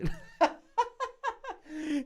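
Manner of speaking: high-pitched cackling laugh; long, high peal of laughter; real laughing fit. Real, not acted. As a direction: crack up helplessly. Cracks up at the accent.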